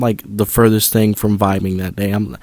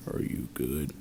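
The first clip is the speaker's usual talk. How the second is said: much softer and lower, flat question; teacher voice; 8 semitones lower, 15 dB quieter, slower than usual. Retold or imitated. Teacher sitting across asking the student, low and soft. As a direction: quietly voice the teacher. Teacher quietly checks on him.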